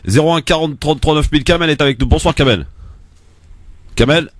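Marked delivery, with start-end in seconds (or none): none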